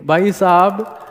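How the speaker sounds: ordinary delivery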